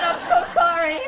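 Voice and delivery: high, loud, laughing sorry; laughing apology. Retold or imitated. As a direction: apologize while cracking up. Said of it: "I said".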